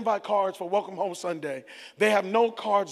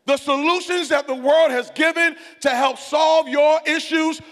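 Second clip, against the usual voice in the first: raised, driving sermon delivery; full-force preaching; 6 semitones higher, 6 dB louder than usual. Real, not acted. Pastor exposing the world's empty promises, full-force preaching. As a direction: pound out each phrase, high and loud.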